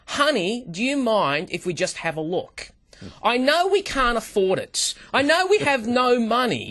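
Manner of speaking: mild imitation of a coaxing wife; wife's voice. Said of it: "my wife says to me".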